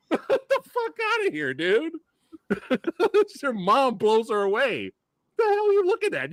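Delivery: high-pitched, laughing disbelief; incredulous mock outrage. Host scoffing at Silk Spectre fans, comic high-pitched outrage. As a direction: squeal your objection way up high.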